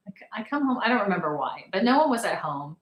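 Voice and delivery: ordinary talk, nothing marked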